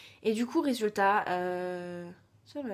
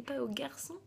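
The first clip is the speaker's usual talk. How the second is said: whispered cheeky aside; whispered tease; 4 semitones higher, 6 dB quieter than usual. Real, not acted. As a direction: drop to a breathy whisper for the joke. Cheeky aside about her mother.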